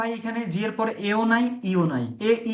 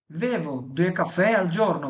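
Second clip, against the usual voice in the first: slow model sentence; much slower than usual. Shown as a demonstration. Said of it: "teacher models Italian sentence".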